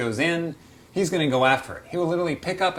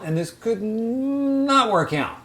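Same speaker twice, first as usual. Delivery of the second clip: slow, much higher drawn-out doubt; mock doubtful aside; 10 semitones higher, 4 dB louder, much slower than usual. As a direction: stretch it out high and slow, half-joking. Imagined hobbyist's doubtful thought.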